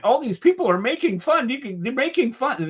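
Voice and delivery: high, whiny mock of a complainer; mocking whiny rant. Host mocks a critic's video rant, whiny and high. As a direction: whine it like a sulking brat. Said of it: "panty-wearing little rant".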